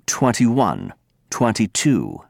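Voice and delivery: twenty-one with a crisp t in twenty; British twenty-one. Shown as a demonstration. Teacher showing students British numbers. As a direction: keep the t in twenty-one, twenty-two. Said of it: "Brits say".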